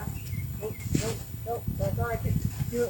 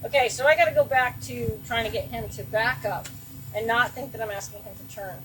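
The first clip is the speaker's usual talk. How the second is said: ordinary talk, nothing marked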